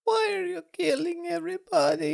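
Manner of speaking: tiny, very high falsetto wail; mock crying child. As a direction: squeak it out like a hammed-up upset little girl. Mimics the crying girl's line.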